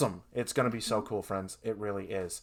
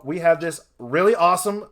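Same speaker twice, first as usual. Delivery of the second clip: ordinary talk, nothing marked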